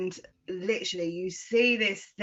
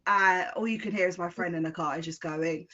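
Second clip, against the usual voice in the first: ordinary delivery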